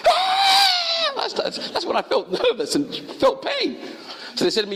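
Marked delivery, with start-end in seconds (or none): 0.1-1.1 very high startled shriek of a woman; lady's high shriek. Retold or imitated. Lady in the street shrieks again at the wound, very high. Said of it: "She goes".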